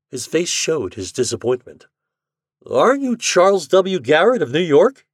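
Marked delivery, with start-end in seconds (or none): 2.6-5.0 louder, higher, slowed demand. Acted. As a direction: insist on an answer. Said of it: "he demanded".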